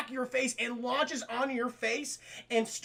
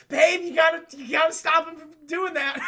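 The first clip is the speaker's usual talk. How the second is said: exasperated plea; 7 semitones higher, 7 dB louder than usual. Retold or imitated. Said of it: "I'm like".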